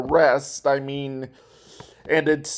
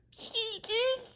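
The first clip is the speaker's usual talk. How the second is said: very high mocking villain voice; Rodney voice. Quoting Rodney taunting Doofenshmirtz, very high voice. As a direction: sneer it high like a smug rival. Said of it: "he says this".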